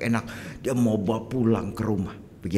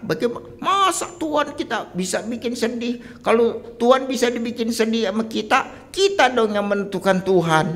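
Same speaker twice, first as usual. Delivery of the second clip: much higher, arguing with disbelief; doubting objector; 13 semitones higher, 4 dB louder than usual. Acted. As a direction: push back like a clever skeptic. (suara tinggi, membantah dengan ragu)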